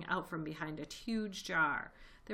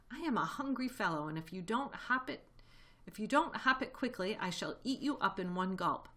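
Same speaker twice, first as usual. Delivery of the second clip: ordinary delivery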